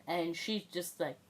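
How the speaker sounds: normal talking voice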